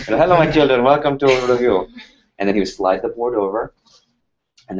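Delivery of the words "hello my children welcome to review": put-on louder, higher light Indian accent greeting. Professor greeting his students in a lightly voiced Indian accent. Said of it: "he had this Indian accent".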